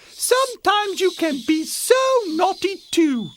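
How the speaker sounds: high-pitched mother bear; scolding Mama Bear. A narrator's reading. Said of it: "said Mama Bear".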